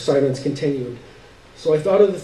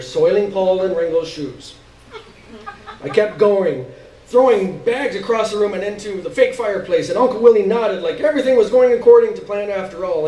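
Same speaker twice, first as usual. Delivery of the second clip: usual speaking voice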